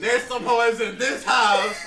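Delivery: high, loud laughing shout. Real, not acted. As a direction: shout it while cracking up. Laughing declaration to friends.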